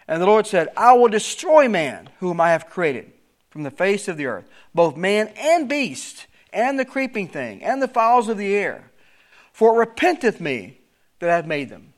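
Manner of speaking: preacher reading the King James text aloud; scripture reading. Acted. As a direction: proclaim the verse with solemn weight.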